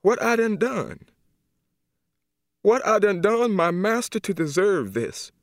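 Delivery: crying out to her master; rising lament. Acted. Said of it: "she was going to cry".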